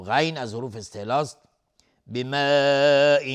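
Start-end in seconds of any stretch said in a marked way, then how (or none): 2.1-3.2 بماء stretched very long and loud; long madd. Shown as a demonstration. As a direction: hold the alif of بماء long. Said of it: "مد واجب متصل". (مد طولانی در بماء)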